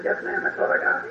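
whispered, sheepish yes ma'am. Retold or imitated. Husband sheepishly answering his wife about the stockings, whispered. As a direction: murmur it under your breath, caught out.

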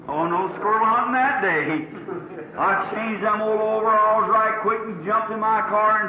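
usual speaking voice

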